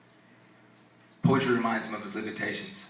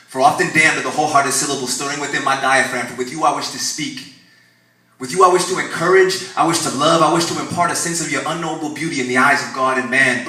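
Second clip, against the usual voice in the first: loud, higher pleading poetry; full-voiced spoken-word climax; impassioned declamation; 4 semitones higher, 11 dB louder than usual. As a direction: pour it out like a desperate prayer.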